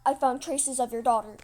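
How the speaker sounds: loud, emphatic news delivery; loud announcement. Acted. Storyteller voicing news of a found daughter, loud announcement. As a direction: announce it loudly.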